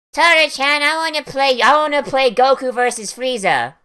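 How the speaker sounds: put-on high-pitched pleading kid voice; child character voice. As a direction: put on an eager little kid's voice. Voices dragon child begging to play.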